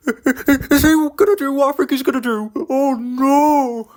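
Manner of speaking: high, anxious car voice; nervous car. Acted. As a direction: fear what the driver will do. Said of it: "Car was nervous".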